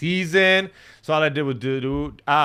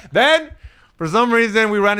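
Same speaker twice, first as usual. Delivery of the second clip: loud, high hype-man build; excited; 6 semitones higher, 8 dB louder than usual. Acted. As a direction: punch it like a hype intro. Hyped recap intro.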